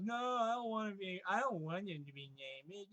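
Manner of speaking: poking fun at Danielle's refusal, high and whiny; mocking imitation. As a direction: parrot her refusal in a silly high voice.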